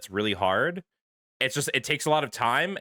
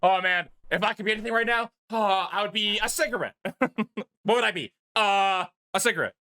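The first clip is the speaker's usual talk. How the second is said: giddy excitement; 8 semitones higher than usual. Real, not acted. Laughing joke about being cigarette.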